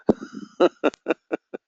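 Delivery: breathy amused laugh. Real, not acted. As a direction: laugh it off, unvoiced. Laughs after his fish boast.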